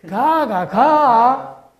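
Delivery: 가가 가 loud, high, final 가 peaked; Gyeongsang pitch accent. Shown as a demonstration. Lecturer shows three-syllable 가 accent loudly for students. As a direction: punch the accent on the last 가. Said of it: "액센트 넣을 수 있겠어요".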